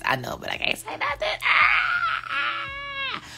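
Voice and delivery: very high squealing voice, then a shriek; excited squeal. Real, not acted. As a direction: squeal over a secret you can't tell. Squealing about secret news.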